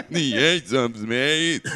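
raspy, drawn out rocker impression; sing-speak it like a sick rocker; slow sick rocker voice. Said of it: "a motorhead with a cold".

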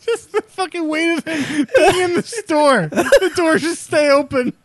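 laughing. Real, not acted. Cracking up while joking.

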